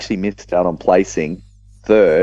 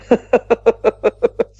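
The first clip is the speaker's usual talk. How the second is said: a sudden high laugh breaking out; high burst of laughter. Real, not acted. Laughs at the card reveal.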